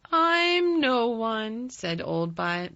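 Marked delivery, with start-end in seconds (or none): 0.1-1.7 slow, drawn-out high dry reply. A narrator's reading. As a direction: stretch it out loud and drily. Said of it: "Ben dryly".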